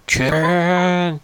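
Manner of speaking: high, sudden squawk of the word; mock scream. Rock creature screaming about the chicken, mock scream. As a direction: blurt it out like a panicked rock creature. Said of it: "And he just screams".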